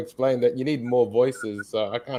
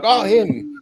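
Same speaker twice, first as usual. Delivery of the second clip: lightly voiced Felipe, higher and louder; a dismissive little oh him; mock recognition; 9 semitones higher, 6 dB louder than usual. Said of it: "he'd be like".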